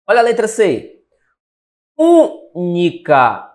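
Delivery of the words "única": "Única" very slow, long stressed ú, then ni, ca; syllable-by-syllable demo. Shown as a demonstration. Teacher marking the antepenultimate syllable of Única, slowly split. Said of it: "a sílaba tônica é a antepenúltima".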